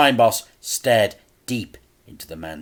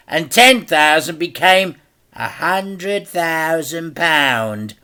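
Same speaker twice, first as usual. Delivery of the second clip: louder, slow, relishing each sum; slow menacing crime boss; 8 semitones higher, 11 dB louder than usual. A narrator's reading. Crime boss slowly inflates Dad's debt. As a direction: count out the debt like a threat.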